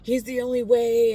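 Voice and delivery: loud, insistent mock grandma; grandma forcing her faith on her, loud and insistent; preachy grandma. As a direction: ram it home like a pushy preacher. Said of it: "trying to stuff it down my throat".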